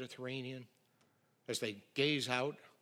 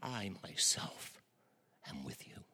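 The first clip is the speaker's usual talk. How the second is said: hushed promise; 6 semitones lower, 4 dB quieter than usual. Acted. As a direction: whisper the promise tenderly. Quotes Jesus again after scoff.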